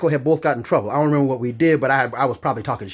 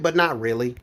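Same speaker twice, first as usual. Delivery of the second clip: usual speaking voice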